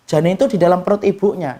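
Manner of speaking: ordinary delivery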